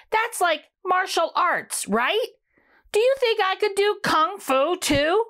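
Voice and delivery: put-on bright little kid asking hopefully; eager young Rudy. Rudy asks the broccoli, high and eager.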